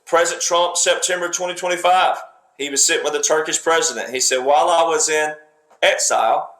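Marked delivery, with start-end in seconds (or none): 4.5-6.5 imitating a long pause before exile, then exile stressed. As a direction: hold a beat after in, then land on exile. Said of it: "he pauses".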